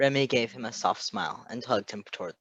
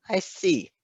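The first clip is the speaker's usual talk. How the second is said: higher, pointed understanding; knowing; 8 semitones higher, 4 dB louder than usual. A narrator's reading. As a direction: let them know you get it. Nurse grasps the situation.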